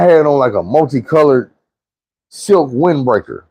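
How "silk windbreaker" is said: normal talking voice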